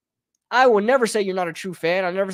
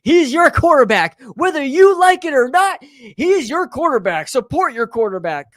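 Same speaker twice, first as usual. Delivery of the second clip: louder and higher, insistent mock lecture; mock preachy fan; 5 semitones higher, 5 dB louder than usual. Mocking a blind-loyalty fan telling everyone to back the quarterback, hammed-up and insistent. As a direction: hammer the point like a zealous fan. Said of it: "I don't speak like".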